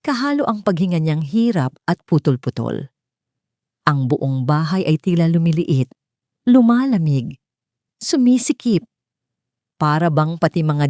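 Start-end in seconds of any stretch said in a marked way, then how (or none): none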